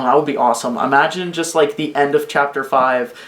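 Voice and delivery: usual speaking voice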